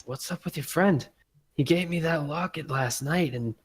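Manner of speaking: a hint of a puzzled, upset woman; hurt barmaid. Barmaid asking Thrust about his friend, lightly hurt. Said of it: "she'll be like".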